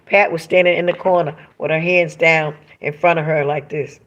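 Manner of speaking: ordinary talk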